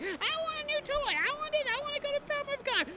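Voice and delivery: put-on very high squealing child voice; high-pitched kiddie tantrum impression; whiny demanding kids. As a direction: mimic kids pestering a parent in a piercing squeak. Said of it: "the kids are just like".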